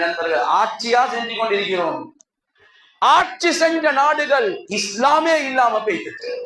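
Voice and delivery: ordinary talk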